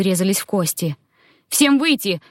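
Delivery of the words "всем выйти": sharp raised-pitch order; commanding. A narrator's reading. Queen clearing guards from the tent, commanding. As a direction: order everyone out in a high, firm voice. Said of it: "приказала им".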